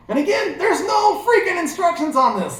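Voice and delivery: high and loud, fed up; exasperated complaint. Real, not acted. Vlogger venting to viewers about missing instructions, exasperated. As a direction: let the frustration burst out.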